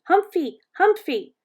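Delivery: put-on high, loud little-kid shout; shouting toddler. A narrator's reading. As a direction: shout it as an excited small child.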